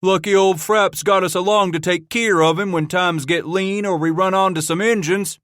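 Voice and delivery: second greenhorn crying out mockery at old trappers; mocking cry. A narrator's reading. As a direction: crow it to make the others laugh. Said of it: "a second cried".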